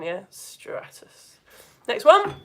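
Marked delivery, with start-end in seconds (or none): 0.3-1.4 dull hushed whisper; boring, blanketed. Shown as a demonstration. Teacher demonstrating a boring blanket cloud, bored whisper. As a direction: whisper it like a flat blanket layer. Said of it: "really boring, like, blanket layered one".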